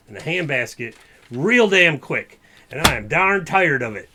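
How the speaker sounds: fed-up outburst. Acted. Sheriff venting to the deputies, hammed-up anger. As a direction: let the frustration boil over.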